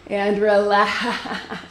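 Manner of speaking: slow laughing release. Real, not acted. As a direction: laugh it out slowly. Coach ends set, laughing.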